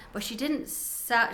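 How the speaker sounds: usual speaking voice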